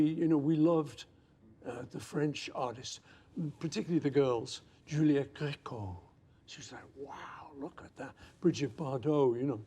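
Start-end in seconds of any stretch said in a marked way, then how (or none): 6.5-8.1 hushed, breathless admiration; whispered awe. Retold or imitated. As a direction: whisper it like you can't believe she's real. Awed whisper about the French star.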